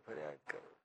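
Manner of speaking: breathed out, soft and voiceless; whispered echo. Shown as a demonstration. Preacher whispering the endless circling to his listeners. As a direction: let the words die away in a whisper.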